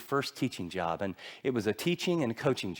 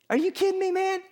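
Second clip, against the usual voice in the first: stunned disbelief. Retold or imitated. Teacher stunned by the job applicant's answer, very high disbelief. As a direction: gasp it out in disbelief.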